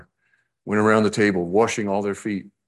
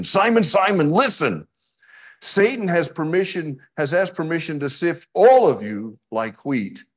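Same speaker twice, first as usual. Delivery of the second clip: emphatic reading; 9 semitones higher, 3 dB louder than usual. Acted. Reading ISV verse emphatically.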